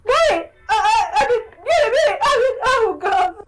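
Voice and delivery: high, sobbing plea; dub line, crying. Acted. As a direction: cry through the words, breaking. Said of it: "another line you haven't heard".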